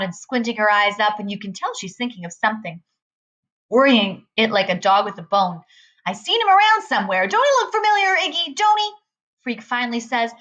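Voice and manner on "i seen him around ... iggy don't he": high-pitched, insistent woman questioning her man; nagging biker's woman. Acted. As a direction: pester him in a high voice. Voices Loretta in story.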